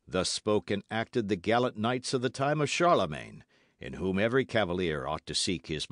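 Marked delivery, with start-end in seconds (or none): none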